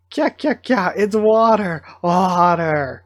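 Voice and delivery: playful squealing outburst; mock squeal. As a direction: cry out at the water, stretching the words. Acts out panicked cry at water.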